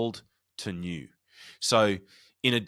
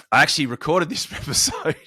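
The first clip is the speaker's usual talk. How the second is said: laughing; 7 semitones higher, 7 dB louder than usual. Real, not acted. Laughs recalling the re-recording.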